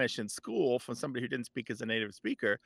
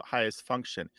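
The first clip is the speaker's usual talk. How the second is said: ordinary talk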